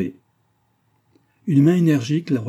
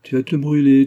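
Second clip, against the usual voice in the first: loud, higher-pitched mimicked warning; merry mockery; 5 semitones higher, 9 dB louder than usual. A narrator's reading. Antonine mimicking her nurse's warning, merry mockery. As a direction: throw the warning back, teasingly. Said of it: "dit Antonine en riant".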